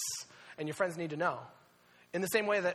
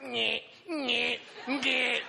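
feeble car horn imitation; 5 semitones higher, much slower than usual. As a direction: honk like someone being choked. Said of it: "getting choked in the backseat".

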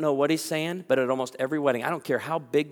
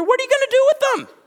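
much higher, louder suspicious squeal; suspicious woman; 9 dB louder, faster than usual. Retold or imitated. Anxious woman questioning the photographer, high squeaky suspicion. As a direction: interrogate the camera holder in a squeaky voice.

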